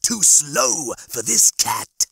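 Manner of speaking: slow, drawn-out cat character voice; cocky chiding. A narrator's reading. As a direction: savour the taunt, stretching each word. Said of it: "he chided his goldfish sandwich".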